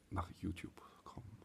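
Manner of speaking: hushed, breathy secret whisper. Pretend. Introducing a shy puppet character.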